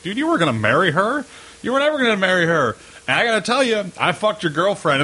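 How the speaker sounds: high-pitched, indignant retort; hammed-up accusing voice; mock quarrel. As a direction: throw it at your buddy in pretend outrage. Acting out friends' quarrel over girlfriend.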